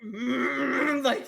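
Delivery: ordinary talk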